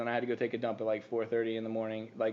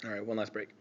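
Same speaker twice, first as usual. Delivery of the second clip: normal talking voice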